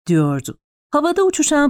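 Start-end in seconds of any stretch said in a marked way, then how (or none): none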